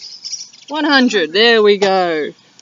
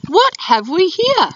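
high fluttery lady voice; fluttery emu; 8 semitones higher than usual. Acted. Emu peering into Dingo's stew, high and fluttery. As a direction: keep her voice up high and prim. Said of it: "she fluttered".

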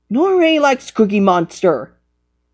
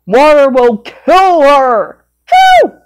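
excited shout; 4 semitones higher, 6 dB louder, slower than usual. Acted. Excited outburst.

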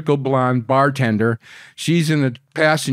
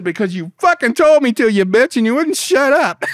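higher, louder mock yell back; angry retort; 12 semitones higher, 6 dB louder than usual. Man yelling back at screaming girlfriend, loud angry retort. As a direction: yell back at her, fed up. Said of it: "And I said".

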